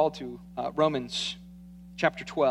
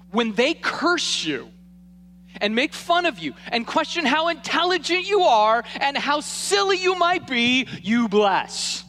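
preaching at full force, higher and louder; rising preaching; 9 semitones higher, 4 dB louder than usual. Acted. Pastor urging his church to bless, preaching hard. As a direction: drive the verse home from the pulpit.

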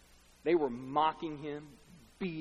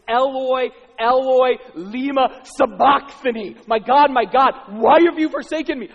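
high, very loud cry to God; loud anguished cry; 9 semitones higher, 15 dB louder than usual. Acted. Preacher as Jesus crying to God, loud cry. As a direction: cry out at full voice, forsaken. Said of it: "he cries out with a loud voice".

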